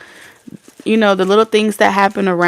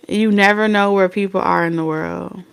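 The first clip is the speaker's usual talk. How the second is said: normal talking voice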